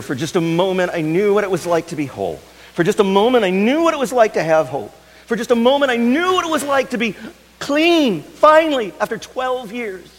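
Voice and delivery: builds from high to very high and loud; rising to a near-cry of relief; desperate confession. Acted. Healed woman pouring out her story at Jesus' feet, building.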